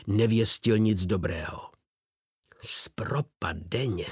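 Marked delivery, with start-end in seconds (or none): 2.6-4.1 whispered oath under the breath; muttered curse. A narrator's reading. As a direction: hiss the curse quietly. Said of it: "řekl". (šeptem, zklamaně)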